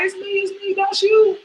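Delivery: begging roommate with a gun, squeaky panic; mock panic. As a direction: beg the roommate not to shoot. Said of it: "I was like".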